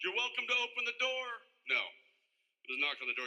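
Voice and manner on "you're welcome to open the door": high, loud, overly courteous welcome; mock polite invitation. Mock polite invite the co-star never actually gave. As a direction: put on a prim, polite host voice. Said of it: "Co-, yeah".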